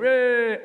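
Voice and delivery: sudden drawn-out "eeeh" noise; imitated car noise. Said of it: "cut into someone and go".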